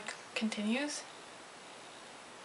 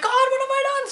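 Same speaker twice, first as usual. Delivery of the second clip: much higher, louder frantic wail; mock panic; 5 dB louder than usual. She realizes she will be late, frantic wail. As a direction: wail it like it is doom.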